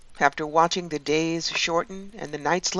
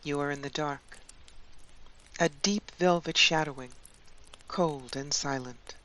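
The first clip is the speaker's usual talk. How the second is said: guided meditation; 7 dB quieter than usual. Acted. Guide leading listeners into a Yule winter journey, guided meditation. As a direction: guide them gently, leaving long spaces.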